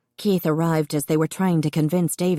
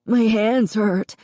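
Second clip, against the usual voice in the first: slow, higher, sobbing a little; burned boy crying to his sister; 5 semitones higher, slower than usual. A narrator's reading. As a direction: push out through sobs. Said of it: "he said, sobbing".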